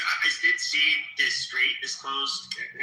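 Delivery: ordinary talk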